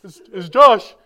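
loud mock reply; phone answer. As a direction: announce yourself loudly in a retold phone call. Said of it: "I go".

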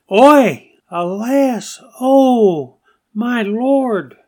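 high, loud cry of alas to God; confessing lament. Acted. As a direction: wail it out as a confession to the Lord. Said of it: "I confessed and said, 'Alas".

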